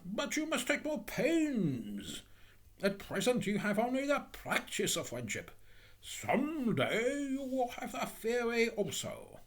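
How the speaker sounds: high, drawn-out, condescending advice; patronising miller. Acted. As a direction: talk down grandly to a pupil.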